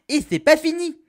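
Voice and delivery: high, loud catchphrase burst; excited announcement. Acted. Catchphrase announcing yet another weapon. (annonce enthousiaste, aiguë et forte)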